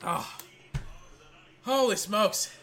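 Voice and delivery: breathless after yelling; panting between words; out of breath. Real, not acted. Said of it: "Let me, let me catch my breath".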